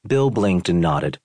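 ordinary talk, nothing marked

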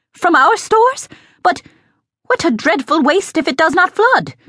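high-pitched, aghast objection; dismayed protest. A narrator's reading. Cook objecting to using up the stores, dismayed. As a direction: protest high, scandalized by the waste.

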